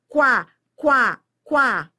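"qua" said loudly and clearly, with the U sounded as a "w" glide; sounding out qua. Shown as a demonstration. Teacher showing the class that the U in "qua" is spoken, loudly. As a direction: punch out "qua" three times, loud, with the U audible. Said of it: "ele é pronunciado".

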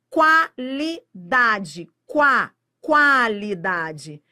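"Qualidade" spelled out slowly, syllable by syllable, with "Qua" stressed. Shown as a demonstration. Teacher sounding out "qualidade" for the class, slow and loud. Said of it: "a banca tá brincando com esse U".

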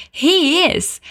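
high, bright confirming "He is."; girl's reply. Retold or imitated.

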